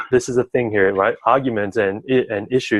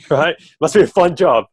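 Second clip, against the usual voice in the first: laughing joke; 7 semitones higher, 4 dB louder than usual. Real, not acted. Joking about listener's job.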